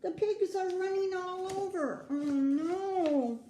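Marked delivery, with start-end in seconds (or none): none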